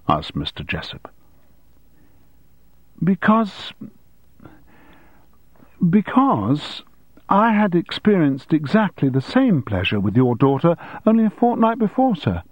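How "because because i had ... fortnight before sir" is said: higher-pitched pupil answering the headmaster; hesitant start, then own up politely in a higher voice; candid schoolboy. Acted.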